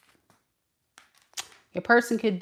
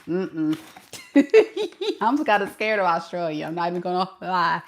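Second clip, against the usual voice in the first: usual speaking voice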